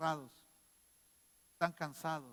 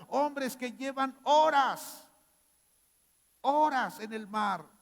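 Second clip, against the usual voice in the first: loud, higher, driving sermon climax; full-force preaching; 8 semitones higher, 10 dB louder than usual. Acted. Pastor urging the congregation about the weary disciples, impassioned preaching.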